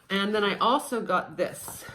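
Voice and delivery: usual speaking voice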